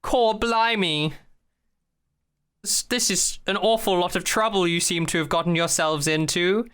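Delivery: high, hammed-up British exclamation easing into chat; put-on posh British accent; mock posh British. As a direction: imitate a chatty posh Brit. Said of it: "in a posh British accent".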